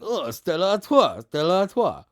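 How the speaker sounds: imitating a Stella Artois advert voice; mock French advert voice. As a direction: ham up a continental beer advert. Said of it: "It's a classic Stella Artois advert".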